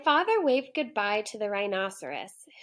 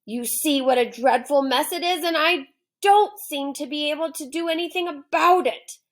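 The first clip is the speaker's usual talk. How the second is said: loud, higher complaining lion; whiny lion; 4 semitones higher, 5 dB louder than usual. Acted. Lion fretting about his messy mane, loud whiny complaint. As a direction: fret about mother and the allowance.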